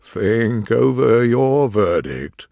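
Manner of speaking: slow, wavering instruction; a touch of tremble, drawn out; trembling voice. A narrator's reading. King turned pale addressing the jury, mildly trembling. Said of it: "in a low, trembling voice".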